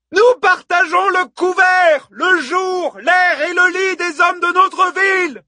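high, slow-building shouted harangue; yelling. A narrator's reading. Hippodamie rouses a roaring crowd of women, yelling. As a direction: yell it out over a crowd. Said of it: "hurla Hippodamie aux larges hanches".

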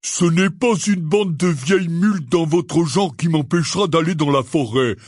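moderately heated defiance. A narrator's reading. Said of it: "répliqua Hagrid en haussant le ton".

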